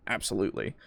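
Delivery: ordinary talk, nothing marked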